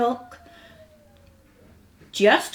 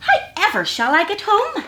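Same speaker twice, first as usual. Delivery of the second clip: worried hedgehog; 9 semitones higher, faster than usual. Acted. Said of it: "struggling with his basket".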